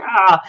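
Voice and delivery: loud, high mock "ah"; imitated cry of reaction. Retelling him hearing his dad's voice, mock cry. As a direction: burst out with an acted cry.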